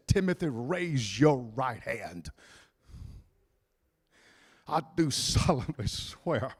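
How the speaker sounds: dying Paul. Retold or imitated. Dying Paul voiced, low slow.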